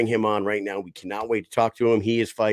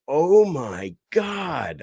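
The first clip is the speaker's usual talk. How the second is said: high, slow, drawn-out "Oh my God"; kid's awestruck gasp; 8 semitones higher, 4 dB louder, much slower than usual. Retold or imitated. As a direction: voice the kid seeing the field in awe. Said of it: "I heard ... out of Nolan".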